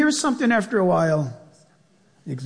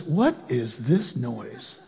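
put-on wondering boy David; 9 semitones lower, 6 dB quieter, much slower than usual. David voiced.

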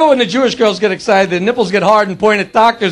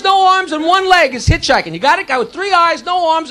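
ordinary delivery

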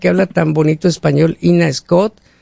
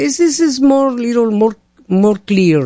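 put-on higher, halting broken English with a Mexican accent; a Mexican accent answering the neighbor; 5 semitones higher than usual. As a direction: fumble for words in English. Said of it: "le digo".

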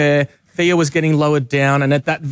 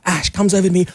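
normal talking voice

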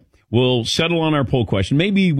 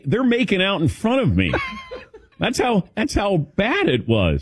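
laughing; 8 semitones higher than usual. Real, not acted. Laughing while telling the story.

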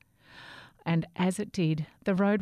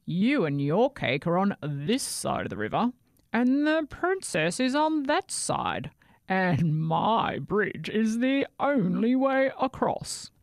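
troll voice; 3 semitones higher, 3 dB louder than usual. Acted. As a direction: lord it over him like a troll. Troll explains the bridge.

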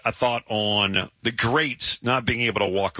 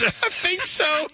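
very high, slow laughing echo; cracking up; much slower than usual. Real, not acted. Host echoing a recovering colleague, cracking up. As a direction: squeal the words through laughter.

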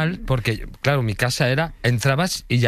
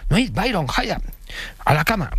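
high sing-out call to pets; calling the dogs; 8 semitones higher than usual. Retold or imitated. Man calling his dogs to bed, high-pitched calling. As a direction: summon the dogs in a raised pitch.

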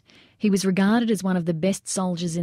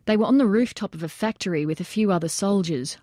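ordinary delivery

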